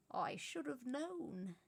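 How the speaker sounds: put-on slow, amused old wizard voice; a private chuckle in the words, slowly; lightly voiced old wizard, amused; amused mutter. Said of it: "He chuckled and muttered".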